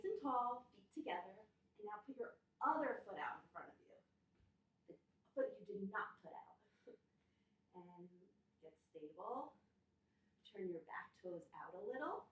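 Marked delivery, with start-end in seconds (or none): none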